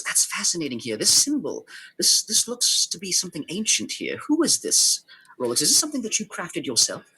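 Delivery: high-pitched, eager inquisitor; curious scholar rising into excitement; fascinated curiosity. Acted. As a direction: question with delighted fascination, voice lifting high. Game master voices fascinated Shyla questioning Rolex.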